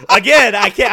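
laughing through the words; real giggle breaking in. Real, not acted. Cracking up retelling story.

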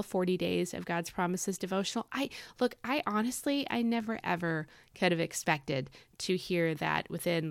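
ordinary talk, nothing marked

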